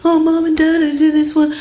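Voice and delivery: high, monotone, timid plea; meek little-kid voice. Retold or imitated. Younger sister showing parents at dinner, high meek voice. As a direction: pipe up high for the parents' attention. Acts herself at family dinner table.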